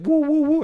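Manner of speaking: high, loud "woo" sound effect; mimicked noise, high and loud; imitated whooping noise. Imitates splashing sound in ear.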